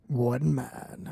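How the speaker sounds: low growling trailer voice, soft; lurid grindhouse trailer growl. Acted. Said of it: "a trailer".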